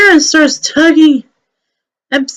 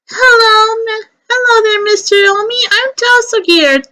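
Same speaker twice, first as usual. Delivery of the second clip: a higher, hurried, puffing courier voice; breathless Omi; 7 semitones higher than usual. Acted. Out-of-breath courier delivering an urgent package, higher and hurried. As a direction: gasp it out after running.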